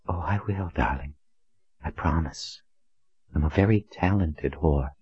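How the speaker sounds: hushed, breathy seductive voice; sultry whisper. A narrator's reading. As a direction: breathe it close to his ear. Courtesan Rose speaks.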